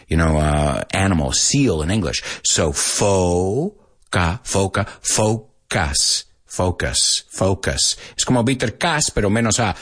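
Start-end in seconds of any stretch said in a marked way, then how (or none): 2.4-8.0 foca then focus, long oh, drawn out slowly; slow pronunciation demo. Shown as a demonstration. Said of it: "You know how to say foca".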